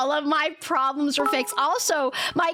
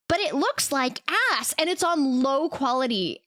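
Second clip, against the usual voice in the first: ordinary talk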